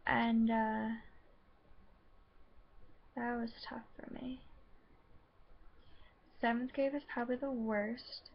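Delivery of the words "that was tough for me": hushed, almost breathless admission; near-whispered confession. Real, not acted. To the camera about being bullied, a real near whisper. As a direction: whisper it, the memory still hurts. Admitting painful school memory.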